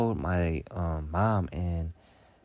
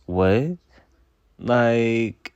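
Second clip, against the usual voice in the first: louder, indignant disbelief; incredulous what; 3 semitones higher, 7 dB louder than usual. Real, not acted. Reacting to the viewer's odd request, incredulous. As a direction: throw it back at the viewer.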